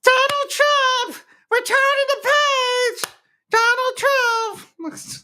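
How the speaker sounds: very high, slow, stretched-out cry of the name; mock high shout. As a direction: wail it out high and drawn-out like a cartoon. Shrill parody shout at show open.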